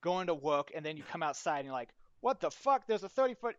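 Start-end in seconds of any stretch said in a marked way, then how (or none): 2.2-3.6 loud, high-pitched startled outburst; acted shock. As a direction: play a bystander suddenly startled by a monster. Acts out startled titan victim.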